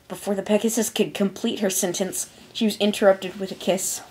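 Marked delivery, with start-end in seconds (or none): none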